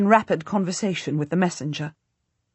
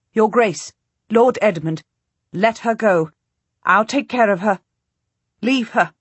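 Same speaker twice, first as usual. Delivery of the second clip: louder, insistent order; firm command; 2 semitones higher, 4 dB louder than usual. Acted. Duchess ordering Edmund away from the queen, firm loud. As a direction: take charge and push him off.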